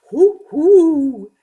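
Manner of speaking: loud, high owl hoot. A narrator's reading. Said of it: "hooted the owl".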